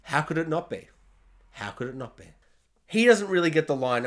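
ordinary talk

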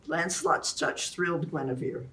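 ordinary delivery, nothing marked

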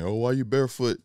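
normal talking voice

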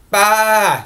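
a little high bleat "Bah"; lamb bleat. Acted.

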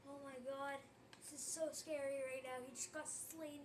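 normal talking voice

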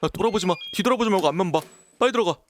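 high, urgent pleading to keep walking; scared player urging the character on, panicked. Real, not acted. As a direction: rush yourself forward in fright. Panicked urging in scary game.

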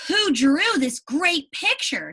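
Lucy marvels at the drawing; excited. Acted. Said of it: "She gasped with excitement".

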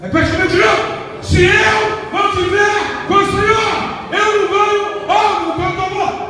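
high, loud fervent preaching; fiery preaching. Acted. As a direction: shout it out to the church. Preaching at full force.

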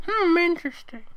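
bored high squeaky hum; not interested. Acted. Twilight brushing off Sunset's news, bored and high. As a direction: fake interest, clearly bored. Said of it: "Clearly not interested".

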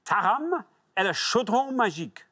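high, swooping French title read; showy French pronunciation; French accent. Acted. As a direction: announce it with theatrical French flair. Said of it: "a French accent, though, don't you".

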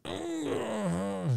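high, soft wordless warning hum; drawn-out wordless hint to hush someone; hinting throat noise. Retold or imitated. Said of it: "like, hinting".

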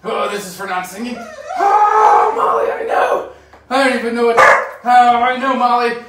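high, loud, shaky from cold; shivering in ice water. Real, not acted. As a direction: talk through the freezing shock. Sitting in ice bath.

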